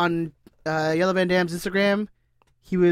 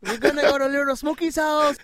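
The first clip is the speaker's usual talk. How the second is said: mock Chicano homie accent, pitched up; 6 semitones higher than usual.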